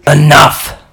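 sharp shouted command; hoarse shout of enough; yell. Acted. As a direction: bark it to stop everything. Character yells to end scene.

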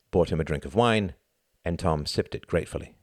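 usual speaking voice

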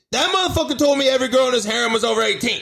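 high, fast, flustered protest; mock panicked. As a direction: play a sweating celebrity making excuses. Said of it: "Just sweating right now".

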